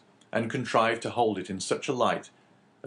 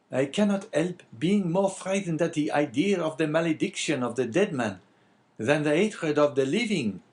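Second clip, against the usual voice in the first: higher-pitched innkeeper character voice; lightly voiced Caderousse; 8 semitones higher, 4 dB louder than usual. Innkeeper answering the abbé, lightly voiced character. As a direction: lightly play the talkative innkeeper.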